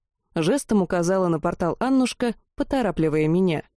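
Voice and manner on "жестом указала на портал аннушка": ordinary delivery, nothing marked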